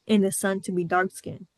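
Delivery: ordinary talk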